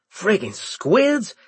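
high, slow exasperated blurt; outburst. A narrator's reading. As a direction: burst out in disgust. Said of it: "I burst out again suddenly".

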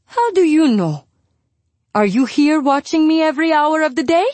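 high-pitched, indignant questioning; irritated mother. A narrator's reading. Mother challenging her worried daughter, irritated. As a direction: throw the questions back, pitched well up.

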